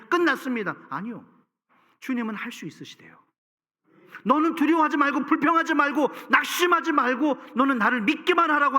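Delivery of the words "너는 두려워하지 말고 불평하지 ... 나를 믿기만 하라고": emphatic command. Acted. Preacher voices Jesus' command.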